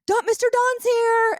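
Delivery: put-on high and loud, a child calling out news; child's excited call. Daughter calling out that Mister Don has arrived, excited and high. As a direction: call out like an excited little girl. Daughter Rainey announcing the visitor.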